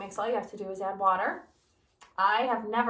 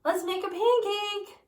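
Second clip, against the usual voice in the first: eager Jack; 9 semitones higher, 4 dB louder than usual. Acted. Eager boy voiced in story.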